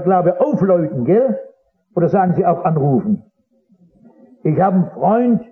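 ordinary talk, nothing marked

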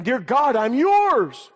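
high, loud cry of surrender; fervent cry. Acted. Believer on the altar offering himself to God, fervent cry. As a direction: call it out to God, pitched high. Preacher acts out surrender prayer.

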